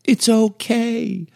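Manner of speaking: put-on high, slow, sing-song soothing of a crying child; mock soothing a crying husband, in a high slow coo; mock comforting. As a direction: coo it like calming a baby, hammed up. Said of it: "you are literally like".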